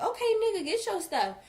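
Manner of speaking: high, loud, fed-up comeback; dismissive retort. Retold or imitated. Voicing own dismissive retort to boyfriend.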